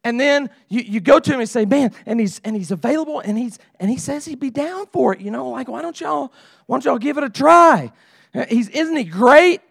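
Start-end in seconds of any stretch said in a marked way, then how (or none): 1.6-9.6 higher-pitched, pushy talking-up; eager matchmaker. Acted. Matchmaker urging a girl to try his buddy, eager pitch. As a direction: sell your buddy hard, lifting on the last question. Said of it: "you give him the whole resume".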